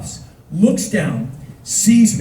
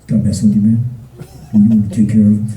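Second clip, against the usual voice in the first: very low, loud bruiser voice; burly friend; 10 semitones lower, 4 dB louder, much faster than usual. Retold or imitated. Burly friend sizing up the teller, very low. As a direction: rumble it deep and heavy.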